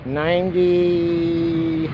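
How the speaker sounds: one long, held word; drawn out. Real, not acted. Reading out the count to viewers, drawn out. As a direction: stretch the word out slowly.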